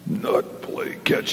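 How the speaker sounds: whispered little puppet grumble; a sulky puppet, voiceless. Acted. Puppet Big Al telling the pastor he's mad, whispered.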